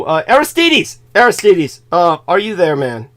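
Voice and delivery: calling out. Real, not acted. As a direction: call him to get his attention. Calling out to absent friend.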